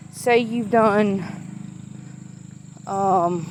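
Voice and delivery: normal talking voice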